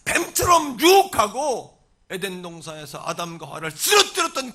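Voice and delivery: loud, forceful sermon delivery; full-force preaching. Real, not acted. Preacher warning the congregation of the devil's attack, full-force preaching. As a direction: preach it hard, driving each phrase.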